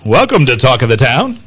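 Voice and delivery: big booming announcer voice; radio voice. Shown as a demonstration. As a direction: boom it out like a DJ. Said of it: "I just had to do radio voice".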